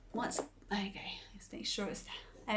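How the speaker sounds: quiet technical check, unvoiced; whispered aside. Real, not acted. Host checking the camera, whispering. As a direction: whisper it off to the side.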